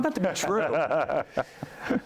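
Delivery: slow, laughing through the words; chuckling at how desperate he was; rueful laugh while admitting it. Real, not acted. Laughing at his own desperation.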